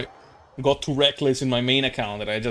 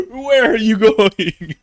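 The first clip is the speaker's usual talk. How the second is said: laughing mock demand; 8 semitones higher, 6 dB louder, much slower than usual. Repeating the demand while laughing.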